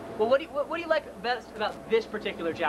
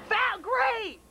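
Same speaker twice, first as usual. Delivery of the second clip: mock delight; 9 semitones higher, slower than usual.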